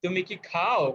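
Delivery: খাও said with extra weight at the end; yes-no question, stress on খাও. Shown as a demonstration. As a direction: lean on খাও, the verb. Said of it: "খাওয়াটার উপরে আমাদের pressure পড়বে".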